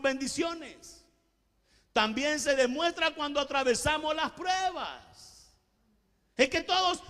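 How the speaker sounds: high, loud fiery sermon voice; preaching at full force. Acted. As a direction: preach it out to the whole church.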